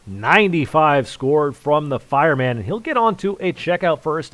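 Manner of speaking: lively caller announcing a score. Real, not acted. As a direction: call the score out loud and high. Live darts score call.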